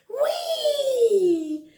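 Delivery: high drawn-out whee with a laugh; joyful slide squeal; gleeful whee. Acted. First ride down the slide.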